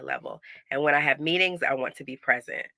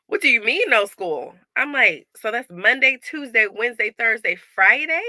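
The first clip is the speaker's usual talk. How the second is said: high, loud outraged disbelief; 6 semitones higher, 6 dB louder than usual. Retold or imitated. Mom realizing school is out all week, high and loud in disbelief. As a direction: count the days in shock.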